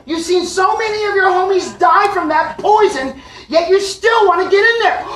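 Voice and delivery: high, loud, incredulous scolding; exasperated yelling. Retold or imitated. Man scolds bee drawn to poison, acted exasperated yelling. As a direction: yell in disbelief at the culprit.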